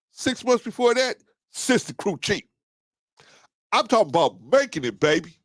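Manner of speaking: bragging crew chief. Acted. Crew chief bragging to the new worker, high and proud. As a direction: strut it like the fast-food crew chief.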